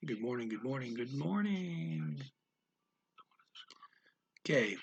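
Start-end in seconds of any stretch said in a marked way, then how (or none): 0.1-2.2 soft echo, last repeat stretched and rising; quiet echoing voice, lingering on the final one; mountain echo. Acted. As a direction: repeat it like an echo, drawing out the end. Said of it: "mountains repeat".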